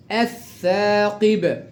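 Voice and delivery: الثاقب with held double th and long aa; lam merged into th. Shown as a demonstration. Teacher drills the second word, lam merged into th. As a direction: recite الثاقب slowly, lam silent. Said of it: "يلا نقرأ التدريبات تاني".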